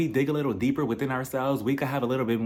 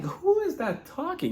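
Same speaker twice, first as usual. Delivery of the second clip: much higher, slow, quieter startled question; mock startled; 4 dB quieter, slower than usual. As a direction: pretend to hear a strange bird. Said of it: "this squawking".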